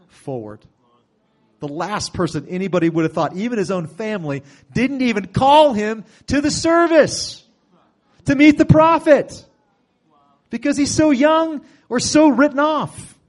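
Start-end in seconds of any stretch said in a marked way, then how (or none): 4.7-13.2 high, loud preaching climb; soaring sermon emphasis; full-force preaching. Acted. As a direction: preach it out with fire. Preaching peak.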